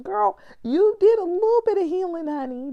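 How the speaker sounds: put-on high, loud, teasing "girl" voice; sassy self-talk. Praising herself in a sassy friend voice, high and loud.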